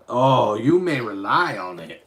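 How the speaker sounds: mock oracle pronouncement; magic eight ball voice. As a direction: read the answer like a fortune teller. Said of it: "Magic Eight Ball".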